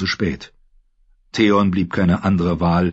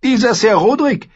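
much higher, louder sister lecturing her brother; Asha, cool and scornful; 14 semitones higher, 5 dB louder than usual. Acted. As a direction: talk down to him, sharp and sure. Asha speaks.

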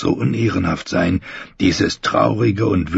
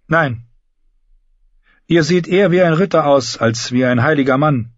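higher, louder character voice; candid female knight character; 8 semitones higher, 3 dB louder than usual. Acted. Brienne telling the brother he looks knightly, frank character voice. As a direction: answer frankly in a raised register.

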